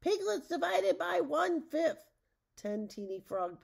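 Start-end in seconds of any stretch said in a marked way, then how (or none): 0.0-2.1 high, ringing spell cast; loud incantation. A narrator's reading. Witch points the stick at two piglets, high loud incantation. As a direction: call out the magic words, pitched way up. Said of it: "she incanted".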